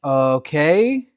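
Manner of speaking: loud, drawn-out questioning okay; mock bemusement. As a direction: stretch it and lift the end, humoring someone. Acts out worker's puzzled reply.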